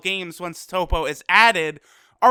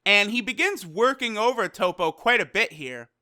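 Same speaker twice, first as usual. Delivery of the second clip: normal talking voice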